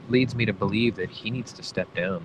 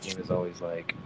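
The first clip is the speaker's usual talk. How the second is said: ordinary talk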